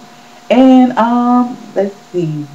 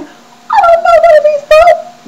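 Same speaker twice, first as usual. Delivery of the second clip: high, loud mock-bewildered fan voice; parodying clueless fans; mock confused old fan; 5 dB louder than usual. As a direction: squeal it like a baffled older viewer.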